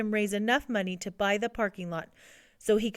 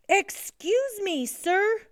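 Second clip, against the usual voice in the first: high, drawn-out polite plea; anxious interruption; 11 semitones higher, much slower than usual. A narrator's reading. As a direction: try politely to get the coach's attention. Lewis interrupts the shouting coach.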